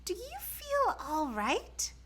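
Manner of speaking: high, slow, gentle concern; worried mother asking. A narrator's reading. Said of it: "she asked".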